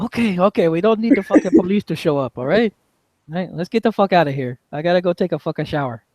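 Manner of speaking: a bit high, hurried calming down; Chino wrapping up. Acted. Chino telling the kinfolk to clear out before police come, a bit high. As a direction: settle the crowd, a little high.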